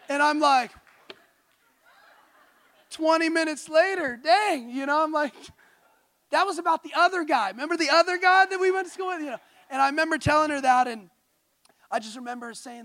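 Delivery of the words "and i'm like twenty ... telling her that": laughing, louder and higher; giddy, laughing retelling; excited laugh. Retold or imitated. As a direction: burst out laughing at the memory.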